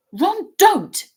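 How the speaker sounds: high, loud angry rebuke. A narrator's reading. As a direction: cry out to stop him. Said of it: "said Hermione angrily".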